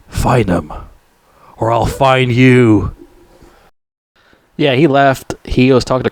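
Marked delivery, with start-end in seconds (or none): 0.1-3.0 lightly voiced action-hero warning; mock menacing threat. Rambo warning Murdoch to find the others, mildly menacing. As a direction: stretch the threat out slowly, a touch put-on.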